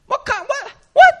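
sputtering high disbelief; mock stunned. Pastor sputtering at the locksmith's price, high and stunned. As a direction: caught off guard, voice jumps high.

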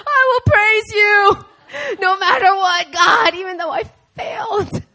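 high, loud, slow cry of praise through tears and laughter; retold prayer, voice breaking; tearful praise. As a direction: shout it to God, half laughing, half crying. Crying out to God in prayer.

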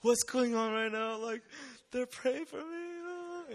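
high-pitched, drawn-out mock wail; hammed-up weepy squeal; imitated tearful crying. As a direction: play up being overwhelmed, voice climbing into a whimper. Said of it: "I'm crying 'cause I'm like".

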